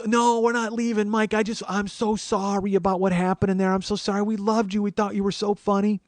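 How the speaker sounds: high, apologetic Wisconsin woman; Wisconsin accent. Retold or imitated. Old schoolmate apologizing to comic after show, Wisconsin accent. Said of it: "she's like, very Wisconsin".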